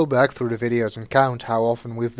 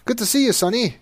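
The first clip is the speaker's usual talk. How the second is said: much higher, louder buddy-cop greeting; 10 semitones higher, 4 dB louder, faster than usual. Acted. Officer at the scene greets Sonny, cheery and loud. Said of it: "he says".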